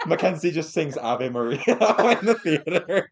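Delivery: laughing. Real, not acted. Laughing while describing scene.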